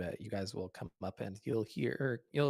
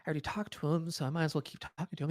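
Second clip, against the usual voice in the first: a bit higher, quick self-justifying; retelling own thought; 6 semitones higher, faster than usual. Retold or imitated. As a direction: rush it like an excuse. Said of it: "and then I thought".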